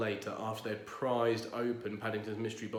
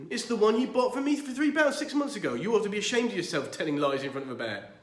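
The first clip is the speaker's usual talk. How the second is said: scolding; 9 semitones higher, 8 dB louder, faster than usual. Acted. Gruber scolding Curry.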